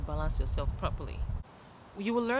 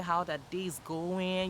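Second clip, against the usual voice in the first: ordinary talk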